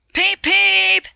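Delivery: high, loud cheerful peep peep; whistle peeps. A narrator's reading. Thomas answering the guard's whistle with high cheerful peeps. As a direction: toot it like a happy little engine. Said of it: "answered Thomas".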